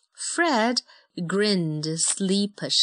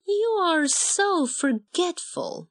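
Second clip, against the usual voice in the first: fond mom; 12 semitones higher, slower than usual. Acted. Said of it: "smiled Mom".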